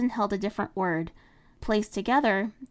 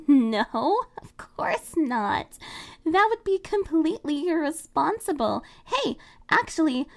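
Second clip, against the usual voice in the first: put-on higher, louder girl voice with a nervous laugh; flustered Marinette; 7 semitones higher, 4 dB louder than usual. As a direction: laugh it off, then eager.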